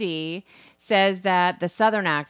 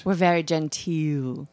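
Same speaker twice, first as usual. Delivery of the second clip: slow, lower put-on Southern belle drawl; a slow, lower, hammed-up Southern genteel drawl; mock Southern drawl; 5 semitones lower, slower than usual. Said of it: "the Southern drawl".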